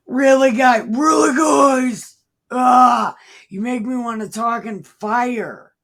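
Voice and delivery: Jesus losing patience with his disciples, loud and high; exasperated yell. Acted. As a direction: throw your hands up and yell. Fed-up Jesus mock outburst.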